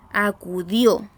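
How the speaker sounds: acudió slowly, weight on the final DIÓ; stress on last syllable. Shown as a demonstration. Teacher showing where acudió carries the voice, final-syllable stress. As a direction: draw out a-cu-DIÓ, landing on the end. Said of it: "se recibe en la última sílaba".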